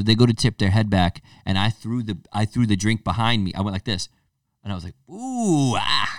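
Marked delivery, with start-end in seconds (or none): 5.1-6.1 fake shot wince. Fake shot reaction sounds.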